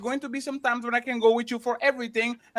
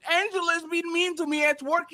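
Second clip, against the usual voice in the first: mock whiny complaint; 6 semitones higher than usual.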